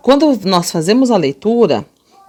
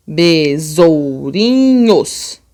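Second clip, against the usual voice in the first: BESOURINHOS stretched syllable by syllable; very slow sounding-out; 2 semitones lower, much slower than usual. Shown as a demonstration. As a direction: draw every syllable of BESOURINHOS right out. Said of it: "Vamos lá, ó".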